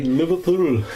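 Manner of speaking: badly faked Liverpool lilt; bad mock Scouse; Liverpool accent. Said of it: "That's a shitty accent".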